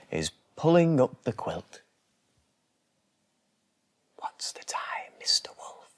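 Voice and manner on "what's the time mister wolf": soft whispered goodnight. A narrator's reading. Friends whispering goodnight to the sleepy wolf. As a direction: whisper it like a lullaby.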